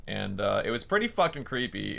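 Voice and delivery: ordinary talk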